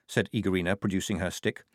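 ordinary delivery

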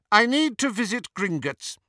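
high, loud, imperious witch; Bellatrix. A narrator's reading. Disguised Hermione telling Travers her errand, imperious. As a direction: state it loftily in a high voice.

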